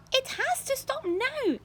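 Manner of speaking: very high, slow anxious aunt; Aunt Spiker yelling. A narrator's reading. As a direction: stretch it out high and worried. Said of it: "Aunt Spiker yelled".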